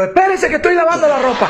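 high-pitched imitated shout of a henpecked husband; hammed-up indignant yell; mock yell back. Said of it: "también le pegó otro".

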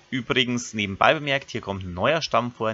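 usual speaking voice

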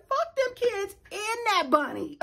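a half-joking fed-up mother. Retold or imitated. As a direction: pitch up, brush them all off. Retold reaction to phone call.

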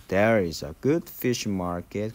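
"There is a" run together as one word, said slowly; slow linked demo. Shown as a demonstration. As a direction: glide "There is a" into "good" with no break, stress on "good". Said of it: "얘가 이렇게 붙어버리죠". ("There is a"를 한 단어처럼 붙여 천천히)

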